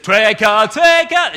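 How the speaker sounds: loud, high tuneless shouted singing; shouting the song. Retold or imitated. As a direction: belt it out like a toddler at the top of his lungs. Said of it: "it just shout at the top of his voice".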